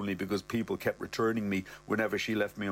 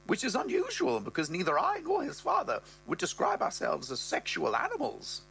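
higher mother voice, rising high at the end; mother's voice; 12 semitones higher than usual. Acted. Mother tells Brett about Ross's libido, in a higher voice. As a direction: voice the mother, pitched up and prim.

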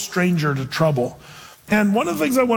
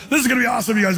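excited; 5 semitones higher, much faster than usual. Retold or imitated. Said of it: "he should have walked up".

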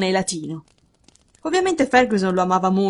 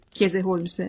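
ordinary delivery

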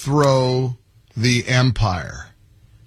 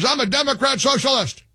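lightly mimicked politician, pitched higher; a hint of Bernie, higher up; Bernie Sanders voice; 9 semitones higher than usual. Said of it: "Uh, Bernie Sanders".

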